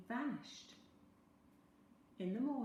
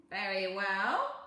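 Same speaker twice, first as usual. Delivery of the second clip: purring; 9 dB louder, slower than usual. A narrator's reading. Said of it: "the little man purred".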